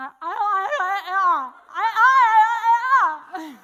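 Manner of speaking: loud, high stuck I's through an open jaw; locked-jaw stammer. Retold or imitated. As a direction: try to speak with the jaw locked. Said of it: "I can talk now".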